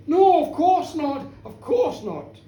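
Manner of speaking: loud, high protest, then settling; indignant minister rejecting the accusation; emphatic denial. Acted. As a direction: deny it forcefully, as if affronted. Minister indignantly denies gentleman's accusation.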